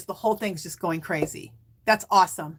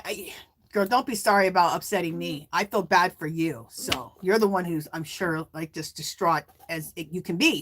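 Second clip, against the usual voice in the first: ordinary talk, nothing marked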